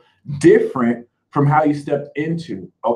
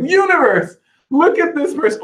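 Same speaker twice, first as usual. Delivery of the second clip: mock appeal to the universe; 11 semitones higher than usual. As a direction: play it up, calling on the universe. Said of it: "Be like".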